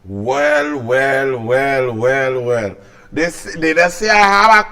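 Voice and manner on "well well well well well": knowing gloat. Acted. New speaker opens with gloating.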